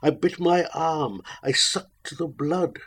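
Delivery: usual speaking voice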